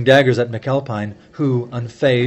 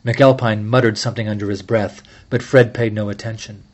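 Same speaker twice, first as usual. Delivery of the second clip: ordinary delivery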